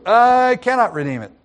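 reluctant kinsman backing out. Retold or imitated. Kinsman refusing once he learns he must marry Ruth. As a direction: back out fast, a touch panicked. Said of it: "Oh, you gotta marry Ruth".

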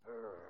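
low, quiet growl; rumbling grumble of angry seamen. Acted. Angry seamen ganging up on Jonah, low growl. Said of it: "growling at him".

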